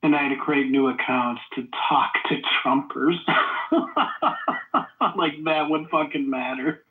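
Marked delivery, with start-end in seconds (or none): 3.2-5.1 breathy scoffing laugh. Real, not acted. Accused of a voice modulator, laughing it off with an airy scoff. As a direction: scoff it off with an unvoiced chuckle.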